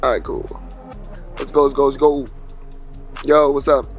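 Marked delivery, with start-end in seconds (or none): none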